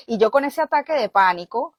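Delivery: normal talking voice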